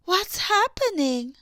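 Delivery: high, loud startled question; sudden alarm at what she sees; high, loud burst of worry. Acted. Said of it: "Anna asked".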